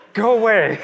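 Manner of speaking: high, loud go away through laughter; dad waving off his daughter, laughing loudly; laughing brush-off. Retold or imitated. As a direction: shoo her off while laughing. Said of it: "I'm like".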